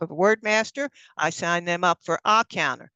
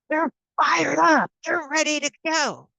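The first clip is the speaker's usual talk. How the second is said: fired up; 6 semitones higher than usual. Shown as a demonstration. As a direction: sound fired up and ready. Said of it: "voicing eager new members".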